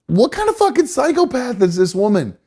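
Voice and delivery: high-pitched exasperated rant; incredulous outrage. Real, not acted. Host reacting to the mother nursing during an interview, incredulous outrage. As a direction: demand an answer in high, outraged disbelief.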